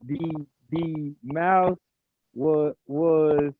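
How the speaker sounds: halting, very slow reading aloud; slow reader. Retold or imitated. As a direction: stumble through it word by word. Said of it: "you know those people that read slow".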